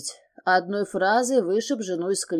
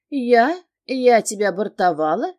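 louder, outraged questioning; indignant cry; 3 semitones higher, 6 dB louder than usual. A narrator's reading. Wife answers her husband's accusation of dumping him, indignant cry. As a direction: throw the accusation back, voice raised. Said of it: "вскрикнула она".

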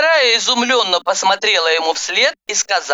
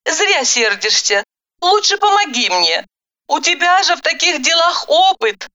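higher, placating woman's lines; voiced heroine; 9 semitones higher than usual. A narrator's reading. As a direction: play her reply in a lighter, higher voice. Narrator voices the woman's reply. (женский голос, примирительно)

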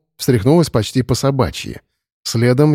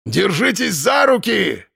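high yell over the storm; shouting over wind; 8 semitones higher, slower than usual. A narrator's reading. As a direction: bark it over howling wind. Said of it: "стараясь перекричать ветер". (крик сквозь ветер)

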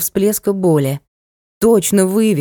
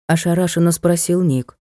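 ordinary delivery

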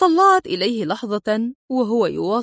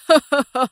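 short higher-pitched guffaw; hearty laugh; 4 semitones higher, 4 dB louder than usual. A narrator's reading. Adib amused at her question, laughing out loud. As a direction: burst out in a brief chuckle. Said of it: "قهقه ضاحكا".

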